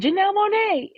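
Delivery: excited name shout-out. Retold or imitated. Viewer greeting [name]'s first scene, excited. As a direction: sing out the name in high-pitched delight. Retold reaction to seeing the actress.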